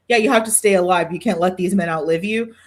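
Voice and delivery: ordinary talk, nothing marked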